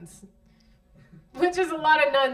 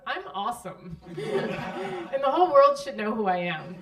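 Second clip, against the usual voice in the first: smug; 4 semitones higher, 4 dB louder, slower than usual. Retold or imitated.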